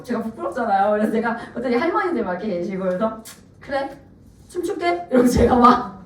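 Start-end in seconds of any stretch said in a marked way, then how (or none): 3.6-5.1 small, soft, reluctant yes; hushed mock-embarrassed promise; retold sheepish agreement. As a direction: give in softly, higher at the end. Embarrassed mother gives in, retold.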